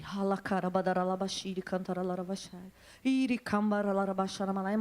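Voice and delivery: low, rapid murmured stream of syllables; speaking in tongues. Retold or imitated. Preacher showing how she prayed for her friend, speaking in tongues. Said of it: "Fala em línguas".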